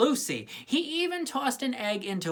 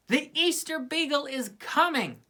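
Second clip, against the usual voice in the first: higher, joyful announcement; delighted cry; 9 semitones higher than usual. A narrator's reading. Linus announcing the beagle to everyone, high and joyful. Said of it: "Linus cried with delight".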